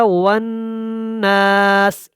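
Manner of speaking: drawn-out chanted tajweed recitation; slow Quran recitation. Shown as a demonstration. Tajweed teacher demonstrating recitation to students, slow chant. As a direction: intone each word slowly, melodically.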